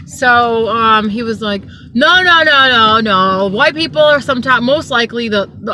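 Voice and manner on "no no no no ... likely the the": loud imitated string of denials; insistent protest. Passenger arguing back at the driver, loud mock insistence. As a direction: cut in loudly, mimicking his insistence.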